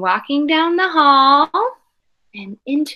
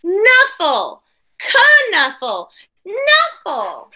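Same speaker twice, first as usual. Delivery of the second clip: put-on arguing kids; 6 semitones higher, much slower than usual. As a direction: trade the word back and forth in a squabble. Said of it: "They're fighting over how to say".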